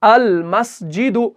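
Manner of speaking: अल मस्जिदु read slowly, each sound clear; slow demo reading. Shown as a demonstration. Teacher demonstrating अल मस्जिदु slowly to students. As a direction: model अल मस्जिदु with the lam held still. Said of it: "लाम को क्या पढ़ते हैं? साकिन पढ़ते हैं".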